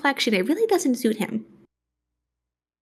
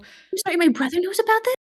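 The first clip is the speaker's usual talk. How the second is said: shocked disbelief; 7 semitones higher, faster than usual. Acted. Dawn shocked in character.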